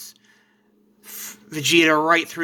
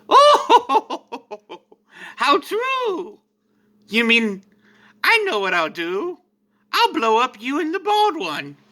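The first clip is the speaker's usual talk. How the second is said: much higher, mocking villain voice with a laugh; Frieza's laugh; 8 semitones higher, 3 dB louder, slower than usual. Frieza mocking the heroes, high and laughing. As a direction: cackle it out like a gleeful tyrant. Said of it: "Frieza goes".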